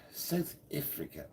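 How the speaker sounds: slow, softly tried South African accent; drawn-out South African vowels, badly faked; attempted South African accent. Shown as a demonstration. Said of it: "I can't do your accent either".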